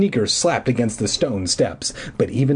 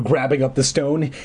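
usual speaking voice

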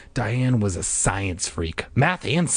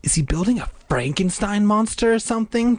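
high-pitched, demanding question; 12 semitones higher than usual. A narrator's reading. Diane asks about the basement, demanding. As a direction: press for an answer, pitched high. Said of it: "Diane demanded".

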